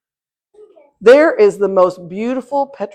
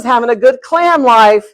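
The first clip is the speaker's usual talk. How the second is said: ordinary talk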